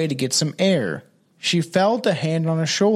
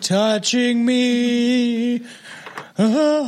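drawn-out higher sultry voice; slow high mock-sultry moan; 6 semitones higher, much slower than usual. Mocking the horny ghost captain, slow and high. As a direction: stretch it out, hammed up.

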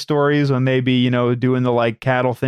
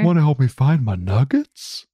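slack jawed dopey Grimace. Pretend. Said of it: "a dopey voice".

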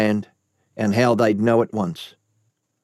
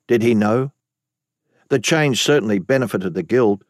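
plain reading voice

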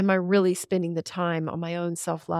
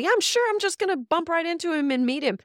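sarcastic; 9 semitones higher than usual. Shown as a demonstration. Showing a podcast guest how she sarcastically doubted meeting Rogan, mock disbelief. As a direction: roll your eyes and scoff it out, pitched up. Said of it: "I said that sarcastically".

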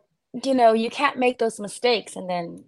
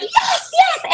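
high, excited cheering; cheering yes. Retold or imitated. Fan cheering the hit, much higher and excited. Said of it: "I jump up and I'm going".

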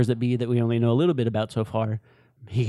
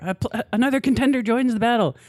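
game announcer voice; 11 semitones higher, 3 dB louder, faster than usual. Retold or imitated. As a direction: hype fighting-game announcer. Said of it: "video game announcer quote".

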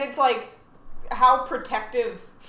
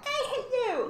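high-pitched mock child outburst; imitated upset kid; 3 dB quieter than usual. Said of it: "if your kid ever said something".

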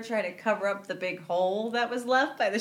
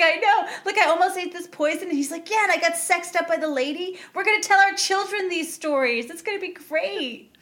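imitating excited Flash; 7 semitones higher, 3 dB louder than usual.

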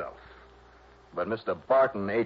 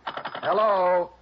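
high, louder hello into a dead line; calling into dead phone; 8 semitones higher, 4 dB louder, much slower than usual. Acted. Johnny calling into a dead phone line, higher and louder. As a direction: call out to a caller who hung up.

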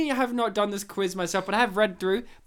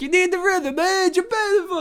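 high Italian New Yorker with rhythm; 10 semitones higher than usual. Retold or imitated. Host showing the Italian New Yorker rhythm. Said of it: "It's like an Italian New Yorker".

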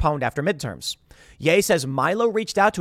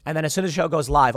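ordinary talk, nothing marked

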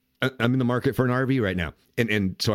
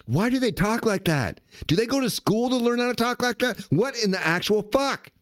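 exasperated disbelief; 11 semitones higher than usual. Real, not acted. Exasperated rant about influencer voices.

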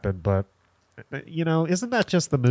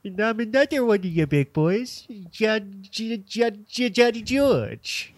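much higher put-on stammering Hubie voice, slow on the name; Adam Sandler Hubie voice; 11 semitones higher, slower than usual. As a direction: stutter the name out in a squeaky oddball voice. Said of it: "this is how we're gonna talk".

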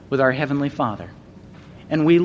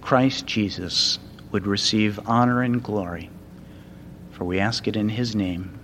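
hushed, earnest prayer voice settling lower; closing prayer; 4 semitones lower, 4 dB quieter than usual. Acted. Preacher leading the congregation in prayer, soft and earnest. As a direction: pray it quietly to close.

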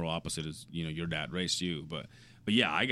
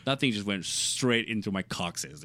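ordinary talk, nothing marked

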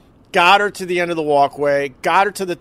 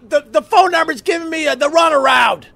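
frantic yell; 12 semitones higher than usual. Retold or imitated. Husband yelling through the glass door, frantic high shout. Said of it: "I go".

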